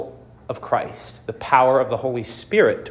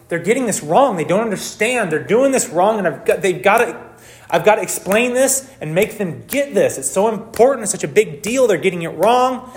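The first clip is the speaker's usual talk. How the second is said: frustrated inner voice; 6 semitones higher than usual. Retold or imitated. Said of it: "if your attitude towards your spouse is".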